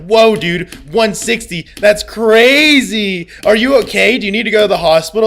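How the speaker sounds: mock alarmed viewer asking if he needs a hospital; mock worried viewer. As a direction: overdo the fake concern of a viewer. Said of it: "it's always like".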